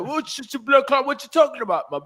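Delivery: high-pitched imitation British street voice; mock British slang accent. Imagining British fans clipping the show and reacting, mock British accent. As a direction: put on an indignant London accent. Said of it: "They gonna be like".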